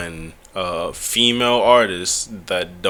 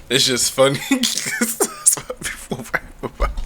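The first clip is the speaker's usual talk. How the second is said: breathless high laugh through words; 5 semitones higher than usual. Real, not acted. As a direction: giggle it out.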